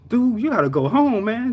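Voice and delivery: high, loud urging; retold alarm. Manager to injured worker, alarmed and high. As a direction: insist in disbelief, imitating a boss.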